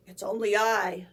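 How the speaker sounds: mild bleating goat, loud and low. A narrator's reading. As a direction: bleat it back bravely. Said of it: "the second billy goat bleated".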